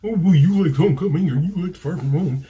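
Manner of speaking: high, loud mock complaining voice; whiny commenters. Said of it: "any fighting or complaining in the comments".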